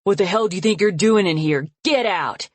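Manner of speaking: loud, furious order to leave; father furious at his daughter, ordering her out; angry. Acted. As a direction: throw her out of the room. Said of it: "just angry".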